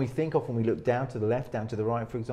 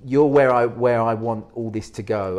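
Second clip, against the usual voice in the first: directly addressing a target; 7 dB louder than usual. Shown as a demonstration. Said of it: "when I look at you and I speak to you".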